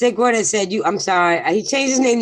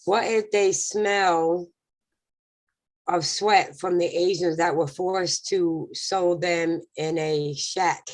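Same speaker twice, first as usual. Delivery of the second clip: slow quoted question; 5 dB quieter, slower than usual. Retold or imitated. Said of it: "Gamer said".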